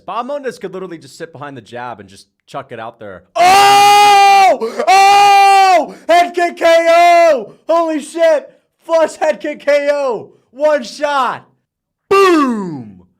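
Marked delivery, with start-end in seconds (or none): 3.4-13.1 very high, very loud knockout yell; ecstatic screaming. Real, not acted. Streamer seeing a head kick knockout, ecstatic screaming. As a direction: scream as the fighter drops.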